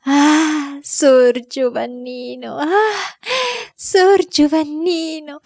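high, slow tearful cry; a maid weeping with joy. Acted. The maid Caterina weeps over the returned boy. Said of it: "si asciugava gli occhi".

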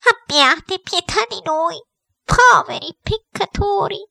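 high, slow wailing plea; imploring headmistress. A narrator's reading. Headmistress begging her uncle's spirit for pity, high and imploring. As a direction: drag out a high cry for mercy.

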